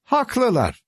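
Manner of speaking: high, pleased exclamation; light up as you agree; delighted. A narrator's reading. Master hearing praise from the village, delighted. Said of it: "Yüzü parlamıştı".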